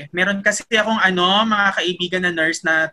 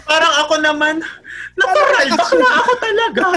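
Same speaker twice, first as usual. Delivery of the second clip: laughing high proud claim; 10 semitones higher, 4 dB louder than usual. Real, not acted. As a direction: voice jumps way up, laughing as he owns the insult. Proudly owning the insult, laughing.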